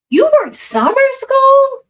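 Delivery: can't believe what she just read; real surprise, pitch jumps up high; surprised. Real, not acted. Reacting to a viewer's chat.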